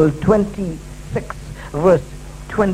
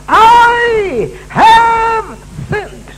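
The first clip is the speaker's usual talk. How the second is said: anguished confession; 12 semitones higher, 4 dB louder, much slower than usual. Acted. Saul's confession dramatized.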